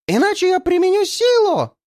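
high, loud threatening yell. A narrator's reading. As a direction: scream the threat. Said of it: "вопит она между грозными взмахами".

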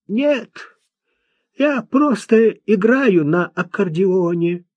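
high-pitched, laboured recital of points; odd, earnest young man. A narrator's reading. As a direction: voice a simple lad trying to speak properly.

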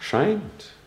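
Scheint flung back on a high rising pitch; a sharp, rising one-word retort to his mother; indignant echo. Acted. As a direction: catch her word and hurl it back, incredulous. Hamlet throws back the word.

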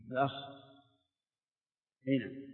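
ordinary talk

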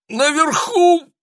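высокий испуганный стон; стонет от ужаса; groaning. A narrator's reading. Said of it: "простонал Шанданьяк". (испуганный стон)

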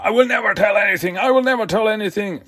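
high, fast mock-heroic shout; defiant shout. Captured ninja defying his captors, fast high hammed-up shout. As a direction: ham up the proud refusal.

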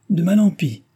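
high, loud mock outrage; indignant cry. A narrator's reading. Milady protesting the doctor's remark, with mock outrage. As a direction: toss it back, affronted. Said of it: "s'écria milady".

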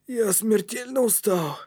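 slow, high, worn-out wheeze; wheezing, exhausted. A narrator's reading. Кнут admits he is deadly tired, wheezing. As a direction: drag the words out wearily. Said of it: "просипел Кнут".

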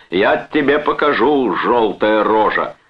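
high, forceful threat; angry shout. A narrator's reading. Brother threatens the fallen man, shouting angrily. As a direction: hurl it after him. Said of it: "крикнул Чарльз ему вдогонку".

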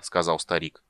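plain reading voice

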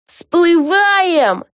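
high, drawn-out call; yelling command. A narrator's reading. Lucy launches the ship from its bow, yelling. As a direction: holler it out like a captain's order. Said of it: "завопила она".

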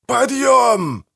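high drawn-out holler; yelled wake-up call. A narrator's reading. Manfred bellows in his sleeping brother's ear, yelling. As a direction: shout it high to jolt a sleeper awake. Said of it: "завопил Манфред так".

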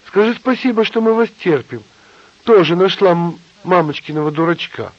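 high, loud scornful tirade; angry shout. A narrator's reading. Nina berating the couple, shouting. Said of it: "кричала Нина".

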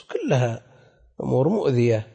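ordinary delivery

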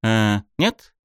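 hesitant, questioning guess; unsure. A narrator's reading. Student hazily recalling school years, unsure. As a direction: offer it tentatively, as a question. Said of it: "неуверенно предположил я".

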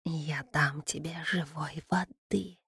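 soft, slow bubbling whisper; gurgling whisper. Acted. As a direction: breathe it like bubbling water. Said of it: "прошептал булькающий голос Водяного".

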